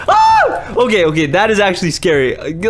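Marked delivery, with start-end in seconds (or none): 0.1-0.7 short, high scream of fright; startled scream. Real, not acted. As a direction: yelp as if jumped. Startled by zombies in game.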